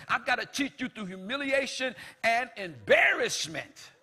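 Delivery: loud, high preaching; fervent preaching. Acted. Preacher urging the congregation about God's love. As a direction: preach it out with fire.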